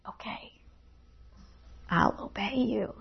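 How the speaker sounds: soft, hushed yielding; a whispered prayer of giving in; whispered surrender. Retold or imitated. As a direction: breathe the words out in quiet submission.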